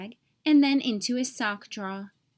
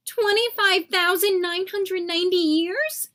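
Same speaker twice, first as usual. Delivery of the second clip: high, loud outraged squawk; 7 semitones higher, 5 dB louder than usual. Acted. Nathan reads the fairy's letter, loud high squawk. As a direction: shriek the number in disbelief. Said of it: "Nathan squawked".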